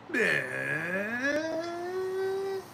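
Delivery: long, high wheeze of laughter; high wheezing laugh. Real, not acted. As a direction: break up laughing. Laughing hard.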